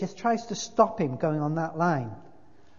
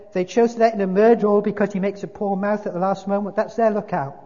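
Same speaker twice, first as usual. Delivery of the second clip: loud, quick grumble; scornful big ghost, aggrieved; 6 semitones higher, 9 dB louder, faster than usual. A narrator's reading. Ghost objecting to a murderer let in, loud scorn. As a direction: complain loudly and fast.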